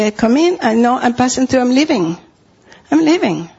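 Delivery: ordinary talk, nothing marked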